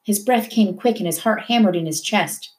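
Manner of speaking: usual speaking voice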